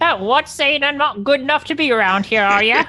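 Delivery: offended beggar. Acted. Player acts an offended beggar.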